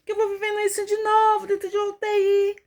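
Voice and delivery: very high, held wail of disbelief; tearful lament. Retold or imitated. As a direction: wail it out as an anguished plea. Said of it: "eu chorava".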